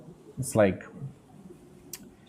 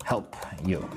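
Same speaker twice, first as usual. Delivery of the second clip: whisper; 5 semitones lower, 5 dB quieter than usual. Real, not acted. Privately offering help.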